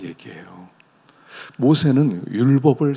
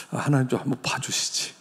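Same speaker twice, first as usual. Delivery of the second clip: ordinary talk, nothing marked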